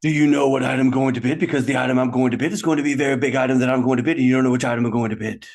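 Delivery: fast, rattled-off bidding talk; rapid auctioneer patter. Acted. Bidder to rival, rattling it off auctioneer fast. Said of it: "sounds like you're actually leading the auction house".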